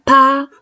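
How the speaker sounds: PA high, loud and level; first tone. Shown as a demonstration. Teacher showing tones of pa, PA held level. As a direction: sustain PA on one high pitch. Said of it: "Primer tono".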